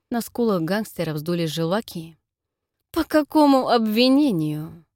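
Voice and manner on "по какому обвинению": hissing with fury, slow; whistling s. Acted. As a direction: seethe through the teeth, sibilants whistling. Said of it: "свистящим от ярости голосом". (свистящим от ярости голосом)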